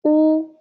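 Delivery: U as "oo", high and level; first tone. Shown as a demonstration. Teacher drilling u line, high flat. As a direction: hold U ("oo") steady and high. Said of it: "And then u".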